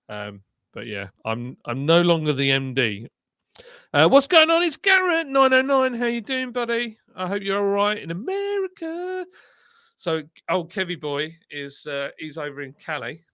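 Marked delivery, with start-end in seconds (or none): 3.9-9.2 high-pitched hollered greeting, easing off in volume; high, excited hello to a viewer; exuberant shout-out. Real, not acted. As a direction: greet a far-off buddy in a big high voice.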